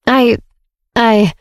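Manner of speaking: halting, broken-off attempts at a word; stammering. A narrator's reading. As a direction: stall on the word, pausing between tries. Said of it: "he stammered".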